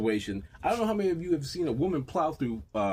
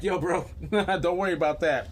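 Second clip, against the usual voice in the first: laughing through a pony's cool reply; 4 semitones higher, 4 dB louder than usual. Retold or imitated. The pony reassuring him, laughing. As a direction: chuckle it out as the pony. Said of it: "the pony was like".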